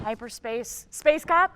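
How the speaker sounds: slow, loud name call. Acted. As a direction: draw out the name as a summons. Chief summoning cops by name.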